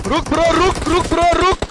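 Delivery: loud, high repeated call to stop; urgent shout. Real, not acted. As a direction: yell to halt a teammate mid-game. Urgently stopping a teammate in game.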